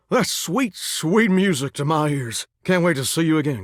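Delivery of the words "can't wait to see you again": normal talking voice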